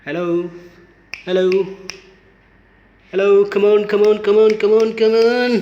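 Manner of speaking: loud, insistent calling; real loud, urgent beckoning; calling the cat. Real, not acted. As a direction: call out loudly to coax a cat over. Calling to get the cat's attention.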